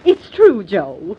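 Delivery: earnest insistence. Acted. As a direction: make him believe it. Alma confirms shocking secret.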